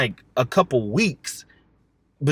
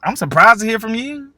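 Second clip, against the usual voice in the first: mock surprise; 7 semitones higher, 5 dB louder than usual.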